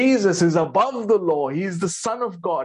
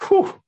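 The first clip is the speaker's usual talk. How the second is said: a high, loud amazed whew; 6 dB louder than usual. Real, not acted. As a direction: let out a stunned whistle of breath. Reacts to quote.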